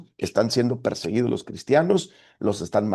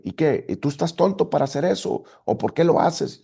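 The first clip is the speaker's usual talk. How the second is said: higher-pitched, re-enacted scolding; mock yelling; 6 semitones higher than usual. Pastor re-enacts yelling at a church member, mock scolding. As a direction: imitate yelling at someone, not full volume. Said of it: "pero gritándole".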